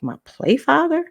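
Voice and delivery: can't believe what you just heard; incredulous. Retold or imitated. Twelve-year-old repeating the teacher's offer in disbelief. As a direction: pitch jumps high in disbelief. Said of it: "And I was like".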